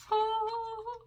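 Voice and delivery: long, high-pitched "oh" of adoration; drawn-out fangirl coo. Real, not acted. Thrilled at showing Mandalorian palette.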